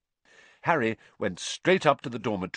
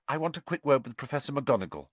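plain reading voice

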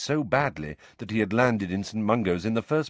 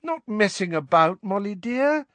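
higher, pleading and hesitant; imploring husband; 11 semitones higher, 3 dB louder than usual. A narrator's reading. Husband pleading with his angry wife, imploring. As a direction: beg her to understand. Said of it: "said Mister Weasley imploringly".

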